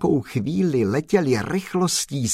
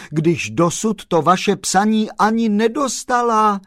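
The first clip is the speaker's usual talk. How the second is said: higher, rattling off names in delight; joyful tirade; 4 semitones higher than usual. Acted. Kolbaba delightedly scolding the lovesick chauffeur. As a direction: pour out the teasing names gleefully. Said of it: "vykřikl pan Kolbaba radostně".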